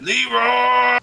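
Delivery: high, long-held mock battle shout; loud gamer war cry. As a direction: bellow it out as a drawn-out charge cry. Imitating meme battle cry.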